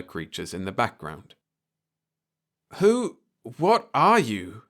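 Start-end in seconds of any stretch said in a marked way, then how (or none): 2.8-4.6 higher, slow, halting question; scared man facing the alien; startled fear. A narrator's reading. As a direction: ask it half in shock. Frightened man wakes on ship.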